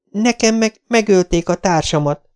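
magasabb hangú, felindult lány; vágj közbe felindultan, magas hangon; indulatos közbevágás. Acted. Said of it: "vágott a szavába a lány". (felindult, magas hangú közbevágás)

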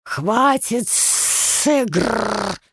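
slow, drawn-out monster roar; ferocious. A narrator's reading. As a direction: bellow it like a furious beast. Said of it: "свирепо воскликнул змей".

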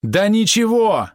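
high, drawn-out cry of dread; horrified. A narrator's reading. Helmsman rebukes the scoffing merchant, horrified. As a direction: let terror push the pitch right up. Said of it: "в голосе кормчего зазвучал ужас".

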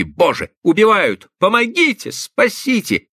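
high-pitched, desperate cries for help; frantic cries. A narrator's reading. Victim screaming for help behind a door, frantic high cries. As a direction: plead for life at the top of your register. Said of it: "потом истошные крики".

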